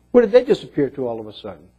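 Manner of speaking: usual speaking voice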